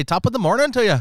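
high, lilting stage Irish; Irish accent. Shown as a demonstration. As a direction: put on a cheery Irish accent. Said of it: "I said, I like Irish".

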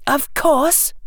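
high-pitched schoolgirl; girl character voice. A narrator's reading. Hermione answering Harry's question, high girl's voice.

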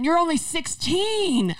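exasperated mom. Retold or imitated. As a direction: wail it like a mom at her wits' end. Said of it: "my mom literally has her hand in her face like".

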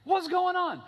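hammed-up what-is-happening; baffled outburst. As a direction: cry out in confusion. Balaam baffled by failed curse.

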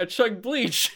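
high, quote squeezed out while laughing; can't hold the laugh while quoting; laughing through it. Real, not acted. Retelling Frank's line, laughing.

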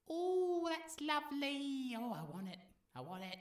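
very high, soft and dreamy; breathy swooning Sita. Retold or imitated. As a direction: coo over it like a smitten girl. Sita enchanted by deer.

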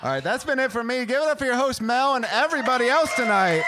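loud, high stage call for applause; rousing sign-off. Real, not acted. Comic handing back to the host, loud rousing shout. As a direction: whip up the crowd at full voice.

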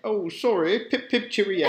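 high, loud mock British accent; clipped wartime posh. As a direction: toff British accent, hammed up. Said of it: "British kind of, like, term".

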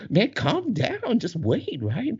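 high-pitched mock soothing; imitating the girls. Other girls telling him to calm down, mimicked high. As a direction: pitch way up, mimic women calming a man. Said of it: "the other girls are like".

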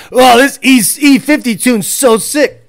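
loud, gushing put-on car guy; mock excited owner. Tuner mocking owners of E fifty tunes, loud mock gushing. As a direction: belt it out as a mocked fanboy. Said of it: "And you're like".

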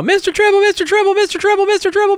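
put-on very high nagging kid chant; goblin kid voice. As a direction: pester the leader in a tiny voice. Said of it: "the Goblin kids are like".

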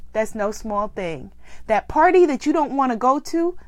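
normal talking voice

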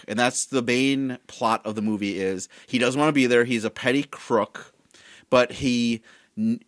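ordinary talk, nothing marked

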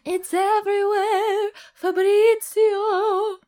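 high slow theatrical cry; dramatic high exclamation. Acted. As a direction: proclaim it grandly.

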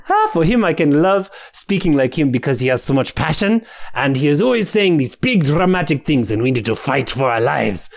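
full sing-song Ugandan accent; passionate, dramatic Ugandan English with breathy "ha" interjections; Akifte. Shown as a demonstration. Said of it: "he's like the full sing-song Ugandan".

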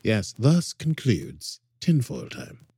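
slow, grand English accent, a bad James Mason; ponderous mock James Mason English accent. Closing the tinfoil segment in a bad James Mason English accent. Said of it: "James Mason always nails it".

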